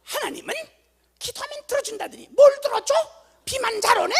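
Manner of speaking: put-on high, loud furious child's complaint; angry outburst. Daughter stomping about the rain on picnic day, furious shriek. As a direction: shriek it out like a furious little girl. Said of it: "걔가 좀 성질이 있는 애인데".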